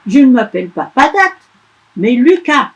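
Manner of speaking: indignant elf. Acted. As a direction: protest like an offended boy. Elf protests.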